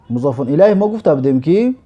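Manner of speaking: ordinary talk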